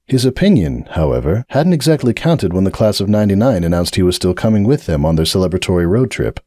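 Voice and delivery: plain reading voice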